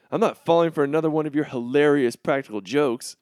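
ordinary talk

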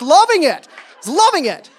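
"loving" swooping up high; big high-pitched stress on "loving"; gushing emphasis. Real, not acted. Relishing the year, repeats for effect.

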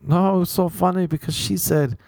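high, put-on explaining voice; hammed-up foreign friend, slow and high; imitated Spanish friend. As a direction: mimic a friend earnestly explaining a joke. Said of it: "And he was like".

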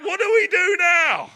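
high loud panic; alarmed. Retold or imitated. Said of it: "It's like".

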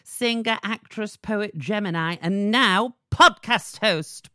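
ordinary talk, nothing marked

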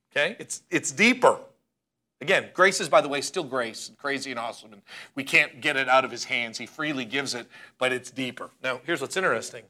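usual speaking voice

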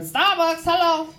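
high-pitched curt service greeting; imitated drive-thru worker. Baltimore drive-thru worker to customer, imitated high voice. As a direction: answer high, like an impatient server.

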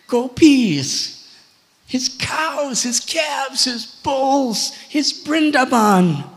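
high, mounting list of wrongs; emphatic rebuke. Acted. Brahma lists whom Indra harmed, emphatic rebuke. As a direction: hit each item harder, ending loud.

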